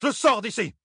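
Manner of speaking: very high-pitched furious exclamation; roared defiance. A narrator's reading. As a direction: announce it in an angry cry. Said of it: "rugit Thalène". (cri furieux, très aigu)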